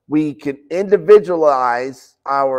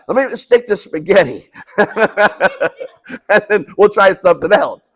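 laughing; 4 semitones higher, 3 dB louder than usual. Real, not acted. Joking about making banana bread.

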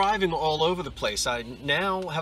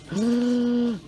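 gasp; 10 semitones higher, 3 dB louder than usual. Real, not acted. Another find.

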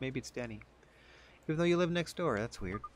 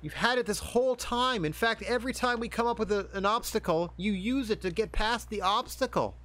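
exasperated friends; 10 semitones higher, 5 dB louder than usual. Retold or imitated. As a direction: shout at him like exasperated friends. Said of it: "all his friends are like".

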